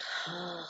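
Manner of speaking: soft, unvoiced breathy sigh; release sigh. Shown as a demonstration. Retelling office meetings, she shows her sigh. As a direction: breathe it out and let go. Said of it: "like that".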